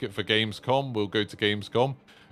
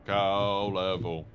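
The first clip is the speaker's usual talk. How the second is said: slow, low, drawn-out "Cow level"; put-on low, slow voice; playful low voice; 4 semitones lower, 4 dB quieter, much slower than usual. Repeats phrase in two voices.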